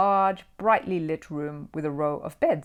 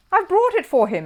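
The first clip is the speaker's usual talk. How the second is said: high and earnest, a little louder; earnest little bear, pitched up; high Paddington voice; 13 semitones higher, 7 dB louder than usual. A narrator's reading. Paddington explains basket.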